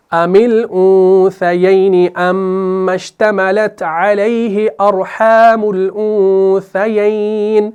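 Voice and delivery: slow, drawn-out Arabic tajweed chant; Quran recitation. Shown as a demonstration. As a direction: recite the verse slowly, stretching each vowel. Recites verse again.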